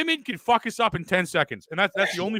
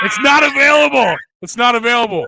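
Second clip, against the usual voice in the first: mock outrage; 6 semitones higher, 9 dB louder than usual. Joke email taken, mock outrage.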